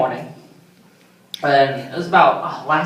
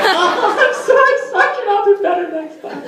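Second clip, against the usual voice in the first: much higher, pleading, near tears; whimpering apology; 3 dB louder than usual. Retold or imitated. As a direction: beg the sergeant for forgiveness.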